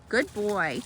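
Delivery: usual speaking voice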